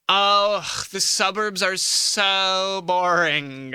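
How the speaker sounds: put-on slow, drawn-out valley girl sigh. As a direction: stretch it out, bored valley girl. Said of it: "to a Valley Girl impression".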